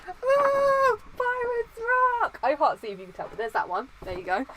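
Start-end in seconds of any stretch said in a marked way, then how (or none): 0.1-2.4 high squealing fan cheer; mock excited fan. Fan squealing the sign's slogan. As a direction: squeal it like a starstruck kid. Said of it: "And you're like".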